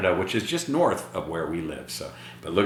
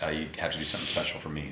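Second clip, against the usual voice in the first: ordinary delivery, nothing marked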